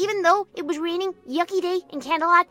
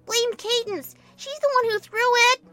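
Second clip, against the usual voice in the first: high-pitched playful blame; giggly protest; 8 semitones higher, 3 dB louder than usual. A narrator's reading. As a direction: pass the blame in a piping voice. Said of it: "I said as I collapsed into giggles".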